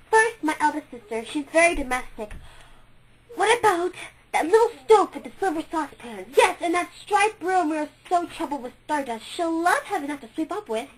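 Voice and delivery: put-on a bright, excited child in a toy shop; Maya's voice. A narrator's reading. Maya choosing gifts.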